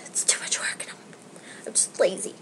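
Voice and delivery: a tired sigh, then whispered words. Real, not acted. To viewers, confessing laziness in a sighing whisper. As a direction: breathe out the excuse in a whisper. Lazy sigh about braiding effort.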